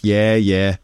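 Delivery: light Manx accent, flat and Irish-tinged; a hint of an older Manx man chatting; lightly voiced Manx Irish-leaning lilt. Shown as a demonstration. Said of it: "more Irish sort of".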